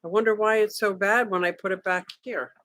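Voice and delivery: ordinary talk, nothing marked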